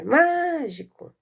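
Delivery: drawn-out calling voice. Shown as a demonstration. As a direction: call mágico as if outside, stretching the first syllable. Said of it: "nós vamos chamar".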